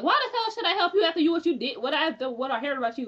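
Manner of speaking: high, fast, rattled-off challenge; retold indignant refusal. Queenie refusing to help after what she heard, indignant. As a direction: throw it back at her, mock outraged.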